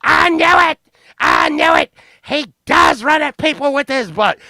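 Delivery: high, hammed-up frantic outrage; Miss Bounds going apeshit. Woman feels proved right before the cops, mock hysterical outrage. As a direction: shriek it like a vindicated accuser. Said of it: "making Miss Bounds go, like, even more apeshit".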